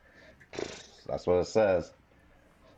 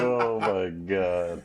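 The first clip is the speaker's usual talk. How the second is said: laughing; much slower than usual. Real, not acted. Laughing at the joke.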